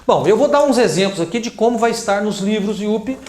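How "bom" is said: ordinary talk, nothing marked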